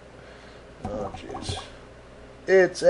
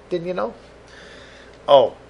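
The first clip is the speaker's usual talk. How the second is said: ordinary delivery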